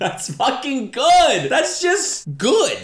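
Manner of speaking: high, drawn-out exclamation; loud, slow, thrilled cry; delighted outburst. Real, not acted. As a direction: stretch the words out in delight. Delighted reaction to pirate lines.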